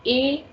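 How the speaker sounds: "école" broken apart, "ay ... kol"; A sound for é. Shown as a demonstration. Teacher sounding out the example for students, "école" split as ay, kol. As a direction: sound out "école" in two parts, opening on a clear "ay". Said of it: "we need to give A sound".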